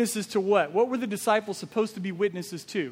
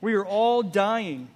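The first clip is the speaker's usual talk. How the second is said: slow solemn repeat; 3 dB louder, slower than usual. Real, not acted. Preacher repeats solemn statement.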